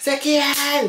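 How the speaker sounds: put-on high, challenging question from a jealous girl; jealous girlfriend. Girlfriend confronts the narrator.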